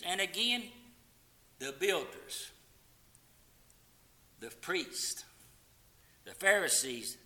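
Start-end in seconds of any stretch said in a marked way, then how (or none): none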